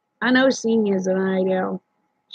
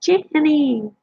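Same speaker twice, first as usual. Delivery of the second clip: greeting pitched high with delight; light up and call her name high; happy hello to a new viewer arriving; excited greeting; 5 semitones higher, much slower than usual. Real, not acted.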